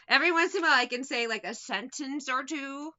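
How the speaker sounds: ordinary talk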